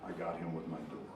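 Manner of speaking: low, hushed, calm priestly blessing; deadpan the punchline like a gentle priest; a priest softly consoling the trucker, low and hushed; serene priest. Acted. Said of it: "And the priest says".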